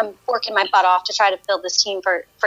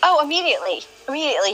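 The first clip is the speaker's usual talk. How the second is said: normal talking voice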